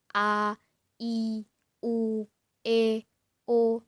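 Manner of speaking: A, I, U, E, O as separate open, distinct vowels; clearly articulated vowels. Shown as a demonstration. Teacher showing how vowels must be pronounced, clearly articulated. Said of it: "itu harus jelas huruf-huruf yang kita baca".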